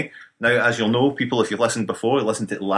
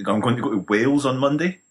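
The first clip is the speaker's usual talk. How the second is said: normal talking voice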